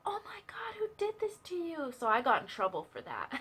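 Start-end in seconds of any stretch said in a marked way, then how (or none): 0.1-1.9 put-on breathy, high gasp of a shocked girl; imitating the girl. The popular girl finding the crying boy, breathy whispered alarm. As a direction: whisper in mock alarm, pitched up. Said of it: "she's like".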